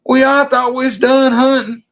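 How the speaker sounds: high, defensive excuse; flustered buddy. Retold or imitated. Buddy explaining why the gun is empty, flustered.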